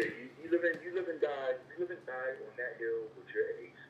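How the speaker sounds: ordinary talk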